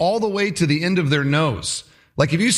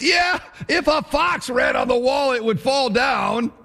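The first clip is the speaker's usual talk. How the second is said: high, over-eager heckle; piling on; 7 semitones higher than usual. Acted. Tobiah piling on after Sanballat's ridicule, eagerly jeering. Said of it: "thinks it's a great time to jump in".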